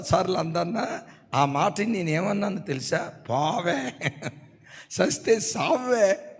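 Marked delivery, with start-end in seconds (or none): 3.3-6.4 slow, careless brush-off; dismissive retort. Retold or imitated. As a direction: drag it out, couldn't care less. Brother dismisses quarrelling wife.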